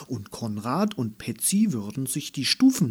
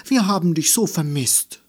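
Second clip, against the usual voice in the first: higher and louder, happy reunion; joyful; 5 semitones higher, 6 dB louder than usual. A narrator's reading. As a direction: gush it out with delight. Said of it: "frohlockte sie". (freudig, höher und lauter)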